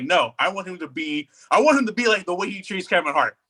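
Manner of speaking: ordinary talk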